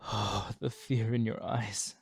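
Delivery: tempted vampire, mounting strain; strained craving. Acted. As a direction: push it out as self-control slips. Vampire losing control near prey.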